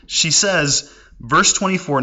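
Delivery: ordinary talk, nothing marked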